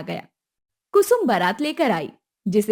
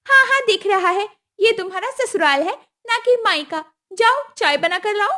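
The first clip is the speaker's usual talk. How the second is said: higher, pushy demand; demanding mother-in-law; 9 semitones higher, 3 dB louder than usual. Acted. Kusum demanding the dowry at the wedding, high and pushy. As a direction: ask for the money like it's owed.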